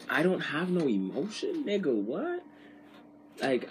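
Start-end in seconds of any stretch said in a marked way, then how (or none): 0.1-2.4 high, disbelieving repeat; baffled, high-pitched rising "what?"; incredulous echo. Retold or imitated. Echoes her accusation in disbelief.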